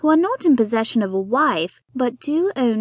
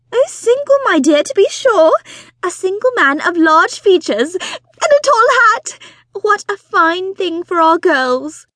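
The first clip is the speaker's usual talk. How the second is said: high, loud, breathless society wife; fussy excited mother; 8 semitones higher, 7 dB louder than usual. Acted. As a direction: gush it out like an eager matchmaking mother. Mrs Bonnet delighted.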